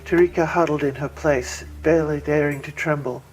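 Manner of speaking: usual speaking voice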